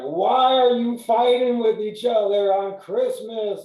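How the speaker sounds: high, loud, slow imitation of her crying; hammed-up weepy girlfriend; mock tearful plea. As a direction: whine it out like she's in tears. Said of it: "she starts crying".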